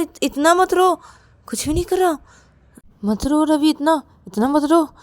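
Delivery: high, loud, stammering protest; flustered panic. Acted. Rahul tells Ravi he did nothing, panicked and loud. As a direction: protest frantically, repeating yourself.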